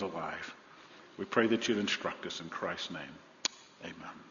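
hushed, reverent prayer voice. Acted. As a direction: pray it softly and humbly. Opening prayer.

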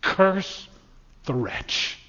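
spat curse. Retold or imitated. Preacher quoting Voltaire, a biting dark curse. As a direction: bite out the curse then sink it. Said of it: "Those were his words about Christ".

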